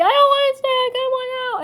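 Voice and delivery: high, loud whiny protest; high loud child's complaint; kids freaking out. Retold or imitated. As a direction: wail it like a trapped upset kid. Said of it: "they're freaking".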